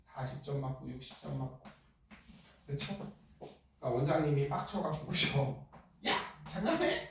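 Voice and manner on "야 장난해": shrill, much higher "are you kidding me"; hammed-up furious principal; mock angry yell. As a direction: snap at the class like an angry principal. Principal angry at low scores.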